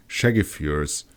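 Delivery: ordinary delivery, nothing marked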